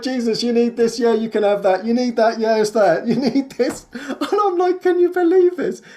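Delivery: high, laughing and giddy; laughing delight. Retold or imitated. Telling Jesus what he needs, giddy and laughing. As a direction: gush with amazed laughter.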